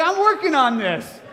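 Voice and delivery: high, loud comic protest; mock strained insistence. Preacher joking about his effort at happiness, mock strained. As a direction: insist it loudly, as if barely managing.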